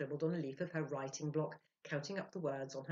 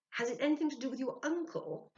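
high, louder probing question; Ludwig's question; 10 semitones higher, 7 dB louder than usual. Acted. Ludwig asking the spirit about the uncle, high and loud. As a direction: press the spirit, pitched up.

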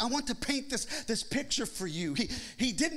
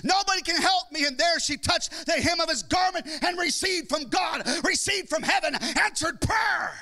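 fiery preaching; 5 semitones higher than usual. Acted. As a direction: preach it out with rising fire. Preacher building to full force.

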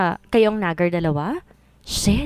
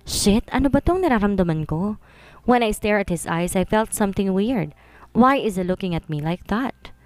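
usual speaking voice

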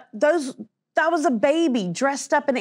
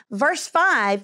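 ordinary talk, nothing marked

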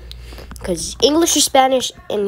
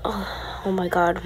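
ordinary talk

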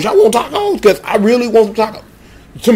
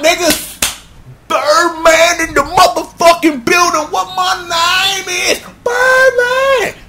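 much higher, stretched announcer call; hype entrance; 9 semitones higher, slower than usual. Acted. Birdman introducing himself to all, high hyped call. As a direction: announce yourself like a star arriving.

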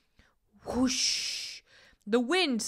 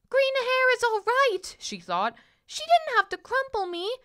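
hurt princess; 5 dB louder than usual. A narrator's reading. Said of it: "wanted to cry".

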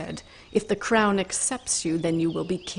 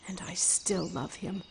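soft whispered confession; tender whisper; 6 dB quieter than usual. Acted. Mergil admitting to Kreia she loves Ryker, soft whisper. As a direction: breathe it out like a secret.

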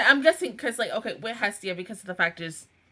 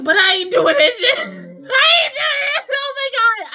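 high, loud wail of refusal; exasperated outburst; 12 semitones higher, 5 dB louder than usual. Real, not acted. Viewer refusing to back the character, exasperated outburst.

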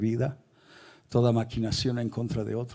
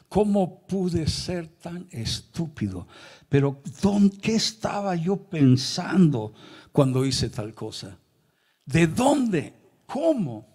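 higher, drawn-out cry of disbelief at oneself; anguished self-reproach; 6 semitones higher, slower than usual. Retold or imitated. Preacher reliving his shame before the screen, anguished self-reproach. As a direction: berate yourself, pitch climbing on each question.

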